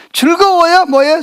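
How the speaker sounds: normal talking voice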